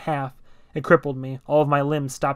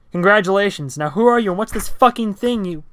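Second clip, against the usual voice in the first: high, loud sarcastic yell; angry shout; 6 semitones higher, 7 dB louder than usual. Acted. Furious driver yelling at the stranger, high and loud. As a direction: snap back hard and loud.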